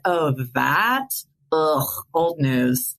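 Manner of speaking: drawn-out, unimpressed brush-off; mock dismissive. Husband joking about his ALS, mock dismissive. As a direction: wave it away with a slow groan.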